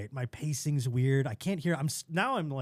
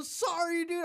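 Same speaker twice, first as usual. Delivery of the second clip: high-pitched, hammed-up apology; squeaky mock-panicked sorry; retold frantic apology; slower than usual. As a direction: apologize way up high, as he runs past. Acted apology as Tom rushes out.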